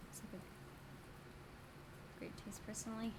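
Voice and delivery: breathy slow whisper; whispered aside. Acted. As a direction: whisper it under your breath. Unvoiced sarcastic aside.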